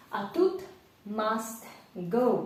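normal talking voice

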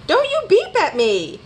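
high, indignant holler. A narrator's reading. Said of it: "hollered Rabbit".

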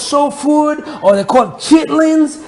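playful American accent, lightly put on; a hint of an American soul-food host. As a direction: slip into a laid-back American accent. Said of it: "I can always talk with my American accent".

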